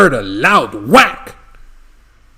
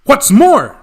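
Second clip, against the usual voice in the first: sharp, higher and louder snap; snapped; 8 semitones higher, 5 dB louder than usual. Acted. Lorax snapping at the Onceler, higher and louder. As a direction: snap it out, dander up. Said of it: "snapped the Lorax, his dander was up".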